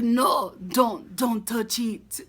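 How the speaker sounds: slow, light Jamaican-accented imitation; a playful hint of a Jamaican lilt; mock Jamaican accent. Fan imitating Grey Worm's accent, lightly Jamaican and slow. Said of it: "Is he Jamaican?".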